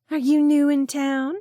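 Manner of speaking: high, loud, flirty come-on voice; flirty woman. Acted. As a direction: purr it like a man-hungry flirt.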